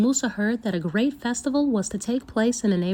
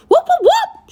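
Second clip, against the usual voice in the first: shrill very high loud monkey calls; monkey whoops; 11 dB louder than usual. Acted. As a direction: whoop back like wild monkeys. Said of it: "replied, shaking their arms wildly".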